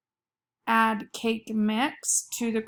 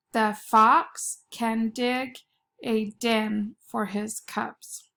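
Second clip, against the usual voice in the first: slow, careful dictation for spelling; slower than usual. Shown as a demonstration. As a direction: read it slowly so kids can write. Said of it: "Listen closely".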